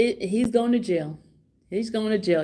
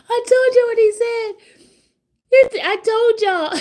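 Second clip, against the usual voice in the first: gloating; 10 semitones higher, 5 dB louder than usual. Real, not acted. Gloating to viewers.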